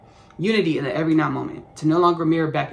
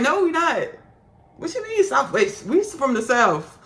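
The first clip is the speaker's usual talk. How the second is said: indignant class retort; 8 semitones higher, slower than usual. Retold or imitated. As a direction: answer back high, as an affronted student. Class quarrel with teacher acted out.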